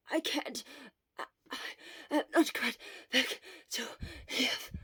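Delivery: winded, coughing gasps; gasping. Acted. Shura out of breath after running, gasping. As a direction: struggle for breath between words. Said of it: "Shura gasped".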